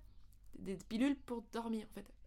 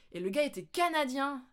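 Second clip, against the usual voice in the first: ordinary talk, nothing marked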